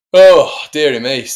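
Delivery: loud, high, drawn-out dismay. Real, not acted. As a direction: groan it out slowly and loudly. Dismay at play going wrong.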